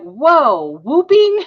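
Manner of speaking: high, loud disbelieving whoa; surprised. Retold or imitated. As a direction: squeal it in disbelief. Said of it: "And I was like".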